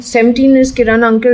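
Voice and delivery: normal talking voice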